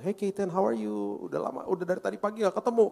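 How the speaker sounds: doting parent's sing-out greeting; affectionate greeting. Retold or imitated. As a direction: greet your kid like you've missed them. Father greeting his son at night.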